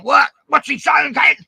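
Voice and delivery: a shocked crowd asking what he just said; bewildered mates. Retold or imitated.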